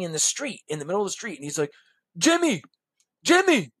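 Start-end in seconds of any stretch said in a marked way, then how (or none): 2.2-3.7 loud, high imitated call. Man standing in the street hailing the seller, calling out. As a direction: holler the name across the street.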